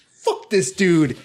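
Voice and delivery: acted-out exasperation; upset outburst. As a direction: retell being fed up, dragging the words out. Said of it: "I was so upset".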